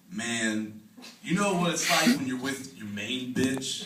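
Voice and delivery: laid-back low drawl. Retold or imitated. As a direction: lean back and drop the voice low. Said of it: "he goes".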